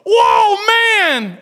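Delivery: loud, high cry of delight; overjoyed whoop; amazed Adam. Acted. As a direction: shout it out like a man who just met his dream. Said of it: "and he goes".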